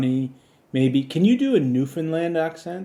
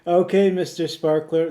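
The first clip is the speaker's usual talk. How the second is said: ordinary talk, nothing marked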